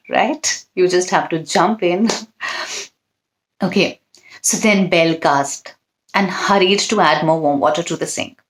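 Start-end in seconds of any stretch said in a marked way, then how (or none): none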